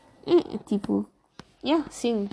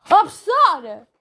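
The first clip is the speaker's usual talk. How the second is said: imitating a loud girl, slow and louder; mock classmate yell; 5 semitones higher, 6 dB louder, slower than usual. Mocking a classmate who calls the teacher, loud mock yell. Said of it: "Sempre que ela fala aos berros".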